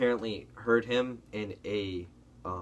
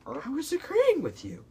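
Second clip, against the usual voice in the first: very high, drawn-out squeal of protest; mock-pleading high voice. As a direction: squeak it slowly, as if wronged. Retold protest to angry player.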